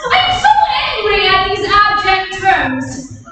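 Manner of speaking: furious outburst. Acted. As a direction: let the anger explode. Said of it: "my choler is so great".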